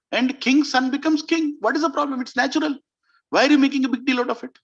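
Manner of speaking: high-pitched, insistent objection; exasperated protest. Acted. Kaikeyi brushes off Mantra's warning, exasperated. Acts queen dismissing Mantra's worry.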